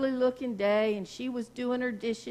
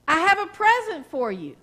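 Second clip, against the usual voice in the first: louder, higher call across the yard; 5 semitones higher, 7 dB louder than usual. Retold or imitated. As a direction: call out a happy offer to strangers. Runs out calling to garbage men.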